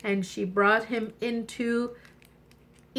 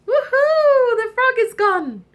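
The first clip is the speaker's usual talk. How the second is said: much higher, loud cheer; joyful whoop; 14 semitones higher, 8 dB louder than usual. Acted. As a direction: shout for joy that he's gone. Princess finds frog gone.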